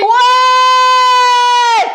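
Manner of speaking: loud, high hype yell; rally the whole class; excited shout. Real, not acted. Hyping class after their answer.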